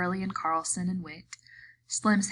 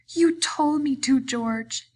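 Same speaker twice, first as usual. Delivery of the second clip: high, pleading and miserable; 8 semitones higher, 6 dB louder, slower than usual. A narrator's reading. Lenny pleading with George, miserable. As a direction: plead like a hurt child. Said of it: "he said miserably".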